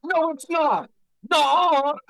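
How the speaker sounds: loud, high outraged protest; angry shout. Retold or imitated. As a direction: yell it in mock outrage. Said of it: "I got, like, angry. It's like".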